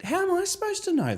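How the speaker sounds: incredulous high. Retold or imitated. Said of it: "I was like".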